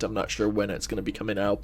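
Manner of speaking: usual speaking voice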